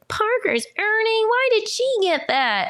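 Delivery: high, drawn-out grating whine; imitating a kid's nails-on-chalkboard complaint; whining jealous child. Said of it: "nails on a chalkboard for me".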